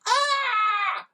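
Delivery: very high yelp; husband's shocked yelp; high cry of shock. Acted. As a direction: yelp from the cold. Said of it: "cried Mister G".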